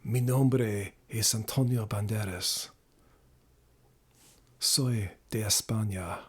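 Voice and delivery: low, slow Spanish accent; Antonio Banderas. Shown as a demonstration. Streamer introducing himself as the actor, slow low Spanish accent. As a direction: introduce yourself slowly in deep Spanish tones. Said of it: "an Antonio Banderas kind of accent".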